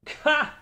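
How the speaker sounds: high, loud sudden laugh; burst of laughter. Acted. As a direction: break out cackling. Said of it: "burst out into laughter".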